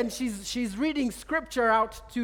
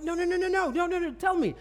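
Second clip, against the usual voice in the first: eager insisting; 7 semitones higher than usual. Retold or imitated. Urging a laughing man to explain.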